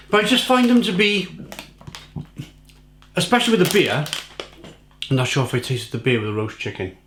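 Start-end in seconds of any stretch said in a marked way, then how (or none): none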